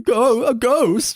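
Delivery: high, drawn-out mock cry of fright; hammed-up haunted-house panic; mock ghost scare. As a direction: wail it high and slow, imitating a scared man. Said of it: "this one guy going".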